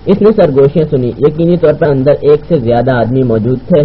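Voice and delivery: ordinary delivery, nothing marked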